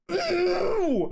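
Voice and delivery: high, loud imitated howl; mock pain cry. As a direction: act out the villain howling at the hit. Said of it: "And he's like".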